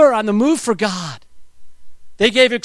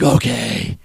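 low dad okay; 10 semitones lower than usual. Retold or imitated. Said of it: "and I'd be like".

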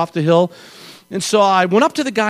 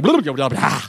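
imitated loose-lipped garble; low babble bursting out at the end; flapping-lips babble; 7 semitones lower than usual. Rider whose lips flap in the spin, garbled babble. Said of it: "your lips are like".

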